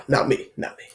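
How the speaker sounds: whispered mock denial; playful whispered refusal. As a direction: breathe it out, refusing it.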